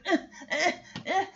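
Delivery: short whimpering grunts, half laughing; reluctant little dog noises; dog squirming away. Retold or imitated. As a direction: mimic a dog straining to get away. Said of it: "trying to, like, get away".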